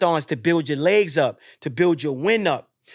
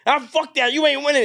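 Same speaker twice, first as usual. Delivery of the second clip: raised, higher acted outburst; retold snapping; 9 semitones higher, 4 dB louder than usual. Frustrated runner turns on the winner, acted angry snap. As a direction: snap in mock fury. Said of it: "you just fucking snap".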